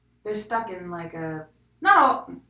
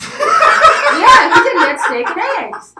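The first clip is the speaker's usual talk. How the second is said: loud laughing agreement; 13 semitones higher, 18 dB louder, slower than usual. Real, not acted. Confirming the cohost's brunch memory, laughing loudly. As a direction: burst out laughing, much louder and higher.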